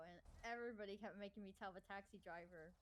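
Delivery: normal talking voice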